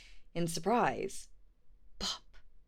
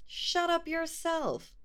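much higher, slow snap; high squeaky troll; 13 semitones higher, slower than usual. Acted. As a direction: drag it out in a silly high voice. Trolls quarrel.

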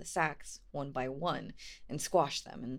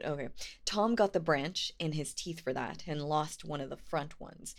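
ordinary delivery

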